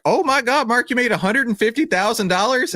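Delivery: mother finally reading the real amount, stunned; astonished mom. Retold or imitated. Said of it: "she's like".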